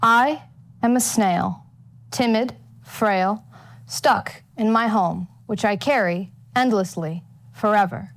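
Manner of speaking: ordinary delivery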